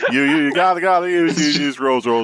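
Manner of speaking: loud, laughing, stuttered chant of the relatives; a whole family droning in unison, laughing; mock family chorus. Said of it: "Abysme's entire family is saying this in unison".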